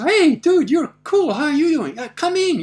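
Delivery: high, loud welcome called across; retold hearty greeting. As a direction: greet an old friend and wave them into the cage. Jimmy calls greeting back, invites in.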